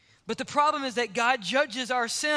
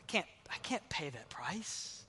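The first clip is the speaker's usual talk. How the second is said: whispered admission; hushed helplessness; 4 semitones lower, 14 dB quieter than usual. Acted. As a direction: drop to a halting near-whisper. Voicing a helpless listener's objection.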